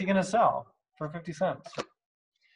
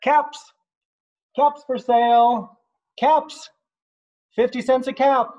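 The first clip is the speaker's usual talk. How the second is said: high, louder peddler's cry; street vendor's call; 8 semitones higher, 6 dB louder than usual. Acted. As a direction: sing it out to passersby.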